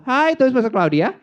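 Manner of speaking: usual speaking voice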